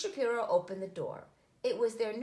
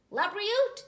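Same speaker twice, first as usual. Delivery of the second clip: bright cheerful; 12 semitones higher, 8 dB louder than usual. Acted. Moti blesses sneeze.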